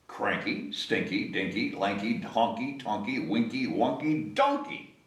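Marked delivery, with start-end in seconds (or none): none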